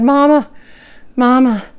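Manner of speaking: urgent call to wake her mother; calling into the dark room for Mama. Retold or imitated. Waking mother in the night.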